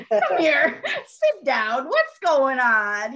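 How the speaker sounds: slow and loud, voicing her dad; dad calmly sitting daughter down, slow loud voice; retelling the dad. Dad's reply retold.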